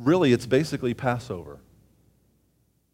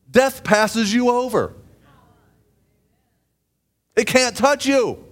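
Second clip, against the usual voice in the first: raised, louder preaching voice, pushed high; fervent preaching; 10 semitones higher, 7 dB louder than usual. Acted. Preacher proclaiming to the congregation, fervent and raised. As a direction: preach it out with fire to the room.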